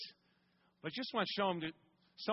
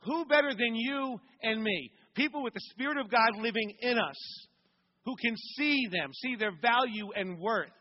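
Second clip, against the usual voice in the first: louder, higher rousing sermon delivery; preaching at full force; 4 semitones higher, 4 dB louder than usual. Acted. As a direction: drive it home to the congregation.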